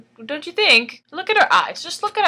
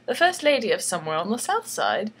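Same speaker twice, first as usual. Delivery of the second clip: ordinary talk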